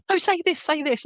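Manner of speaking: high, eager pushy mum's voice; mum urging. Retold or imitated. Medium voicing the mum nudging her to speak, high and eager.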